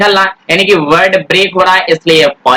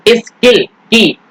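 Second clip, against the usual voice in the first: slow sounding out; 3 semitones higher, much slower than usual. Shown as a demonstration. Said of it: "और यहाँ पर skilfully".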